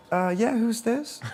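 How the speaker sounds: drawn-out and high, unsure who's on the line. Retold or imitated. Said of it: "And he".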